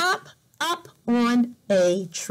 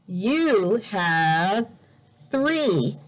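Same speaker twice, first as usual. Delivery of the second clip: ordinary delivery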